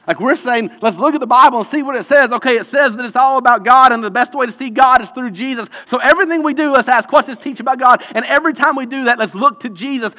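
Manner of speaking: loud, high, urgent sermon delivery; impassioned preaching. Real, not acted. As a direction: drive the point home to the whole room. Preaches at full force.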